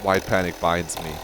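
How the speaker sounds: ordinary delivery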